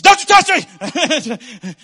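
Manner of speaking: high, loud, drawn-out mock scream; retold scream. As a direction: scream it out like a panicked comic retelling. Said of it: "I screamed, I said".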